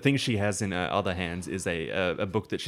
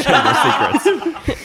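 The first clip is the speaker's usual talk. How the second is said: louder, laughing announcement; 13 dB louder, slower than usual. Real, not acted. GM jokes about spoilers.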